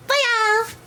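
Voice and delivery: squeaky high cartoon Poyo; funny high voice. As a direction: chirp it high and cute. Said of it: "At least the funny voice anyway".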